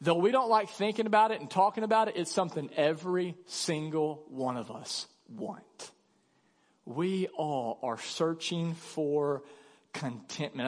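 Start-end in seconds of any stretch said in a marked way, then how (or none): 2.2-10.6 hushed sincere. Real, not acted. Sinks to hushed earnest tone.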